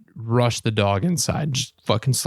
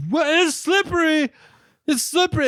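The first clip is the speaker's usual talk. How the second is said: imitating the girl. Mocking the girl slipping downhill, high voice. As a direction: squeak it out like a clumsy girl. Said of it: "she's like".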